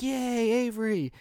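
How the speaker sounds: high, slow cheer. Retold or imitated. Dad cheering his baby's first roll, high and slow. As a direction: cheer the baby on, drawn out.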